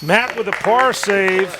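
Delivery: slow, loud big-moment call; excited call. Real, not acted. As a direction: call out the save.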